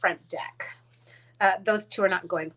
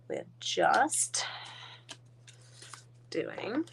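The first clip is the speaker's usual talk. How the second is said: whisper; 7 dB quieter, much slower than usual. Real, not acted. Quiet aside while working.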